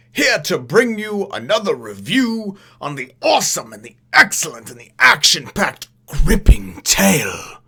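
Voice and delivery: loud, slow, drawn-out announcer greeting; booming show host. Acted. Host greets his viewers in a booming, slow showman voice. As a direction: welcome the Nerd Nation like a big TV host.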